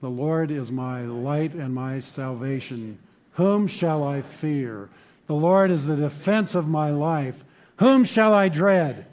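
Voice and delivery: a psalm read aloud, rising to a ringing close; congregational reading. Shown as a demonstration. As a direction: read together, swelling toward the last question. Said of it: "Let's read that verse together".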